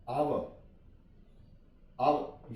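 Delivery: आवर with no H and no final R; silent R. Shown as a demonstration. Teacher reading the word for learners, silent R. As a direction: say आवर as "ah-wuh". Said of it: "यहाँ पे भी आर silent है".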